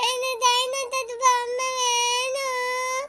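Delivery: put-on wailing little-kid cry, words drawn out. Little girl crying to be given a toy, wailing. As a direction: cry and whine slowly for the toy. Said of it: "அழ ஆரம்பிச்சா".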